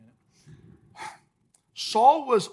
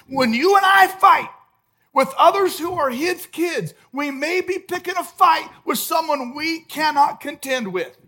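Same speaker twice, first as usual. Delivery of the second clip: forceful warning; 6 semitones higher, 9 dB louder than usual. Real, not acted. Preaching warning at full force.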